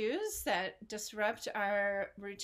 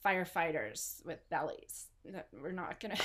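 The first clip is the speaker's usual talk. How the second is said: usual speaking voice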